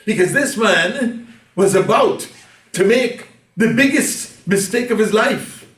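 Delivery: mistake of his life, high and loud; preaching climax. Real, not acted. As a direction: hit "biggest" hard at full preaching force.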